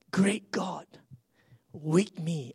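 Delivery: "Great God, with me" breathed as a whisper. Shown as a demonstration. Pastor praying to himself in a whisper, "Great God" whispered. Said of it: "whispered repeat".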